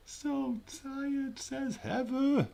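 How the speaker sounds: very high, stretched-out silly voice; mock whiny quote. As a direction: squeal it as a teasing imitation. Playfully relaying Heather's complaint.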